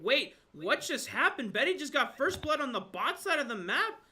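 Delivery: sudden loud disbelief; shocked. Real, not acted. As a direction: shout out in real surprise. Shock at a surprise first blood.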